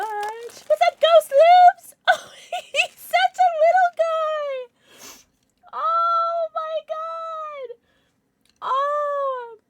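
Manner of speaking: very high, drawn-out cute-overload squeal; adoring squeal. Real, not acted. Streamer greeting her little ghost plushie, adoring squeal. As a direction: squeal and laugh, stretching words up high.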